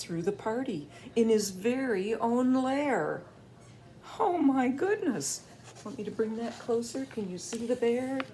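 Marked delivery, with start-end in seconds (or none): none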